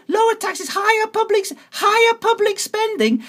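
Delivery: high mock reading of the slogan; mocking quote. Voter mocking a party leaflet, high sarcastic read-out.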